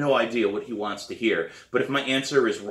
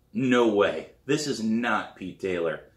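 ordinary delivery, nothing marked

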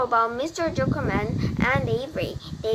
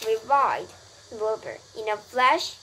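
ordinary delivery

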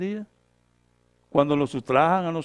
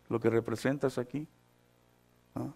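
ordinary delivery

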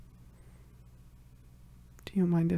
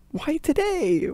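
higher and louder, exasperated cry; frustrated outburst; 8 semitones higher, 6 dB louder, slower than usual. Acted. To her partner, blaming herself, frustrated cry. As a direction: throw the question out in frustration. Frustrated self-reproach, repeated question.

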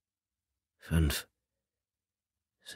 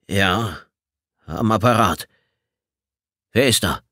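curt, clipped. Acted. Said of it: "Abgehackte Worte, schroff".

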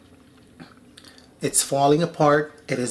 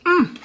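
high delighted mm; 13 semitones higher than usual. Real, not acted. Tasting food.

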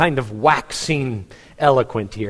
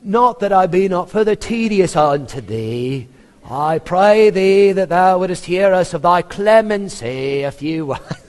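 pompous flatterer impression; 4 semitones higher than usual. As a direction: ham up the fawning lawyer's flowery plea. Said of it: "I kinda think he said it more like this".

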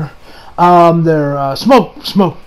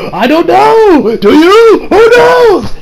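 playfully excited; 11 semitones higher, 4 dB louder, much slower than usual. Real, not acted. Rising excited rhetorical questions.

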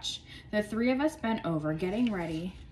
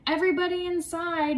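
high, loud teacher call; 10 semitones higher, 5 dB louder than usual. A narrator's reading. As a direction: call the class in. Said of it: "Missus Burke called".

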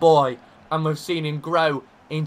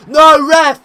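loud, high outraged cry at the referee; protesting shout; 12 semitones higher, 15 dB louder than usual. Real, not acted. Manager appealing to the referee, outraged shout. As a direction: yell your protest at the ref.

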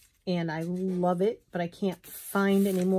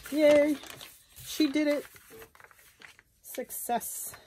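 mild cheer; 7 semitones higher, slower than usual. Real, not acted. Celebrating torn page.

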